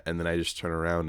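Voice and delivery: ordinary talk, nothing marked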